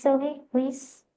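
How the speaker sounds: ordinary talk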